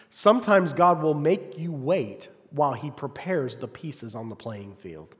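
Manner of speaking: ordinary talk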